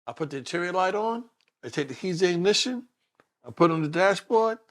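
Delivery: ordinary delivery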